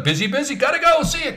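high, hurried comic sign-off; Larry David. Retold or imitated. Busy accountant ending the call, high Larry David impression. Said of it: "just like in Larry David's voice".

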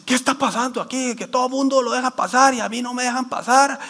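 hammed-up outraged protest; imitated angry yelling. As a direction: mimic an outraged complainer yelling at a guard. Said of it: "le empiezan a gritar al".

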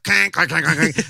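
clanking noise of fumbled guitar notes; imitated guitar clanks. Telling friends how the drunk guitarist sounded, imitated clanking. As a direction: mimic a botched-note clank, in a rapid run. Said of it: "it's like".

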